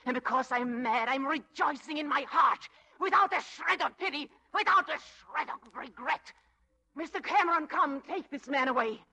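rising, loud, vindictive climax; triumphant outburst. Acted. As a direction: let the gloating swell into a call.

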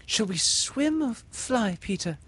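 put-on girl's question. A narrator's reading. Windy asking Peter.

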